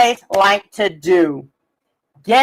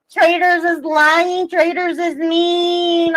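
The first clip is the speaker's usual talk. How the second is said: slow, sing-song mocking of critics; mocking whine; 5 semitones higher, much slower than usual. Mocking the haters' sing-song complaints about Traitors. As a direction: drag it out like a taunt.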